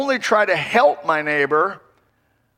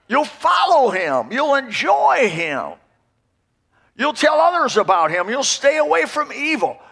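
raised, slow, ringing preacher's call; fervent preaching; 7 semitones higher than usual. Real, not acted. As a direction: preach it out to the congregation. Preaching at full force.

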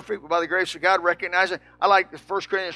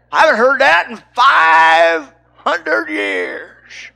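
slow, loud mock old man; amazed old preacher; 6 semitones higher, 8 dB louder, much slower than usual. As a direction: drag it out like a stunned old preacher.

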